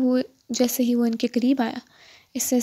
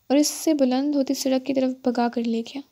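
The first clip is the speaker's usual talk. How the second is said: usual speaking voice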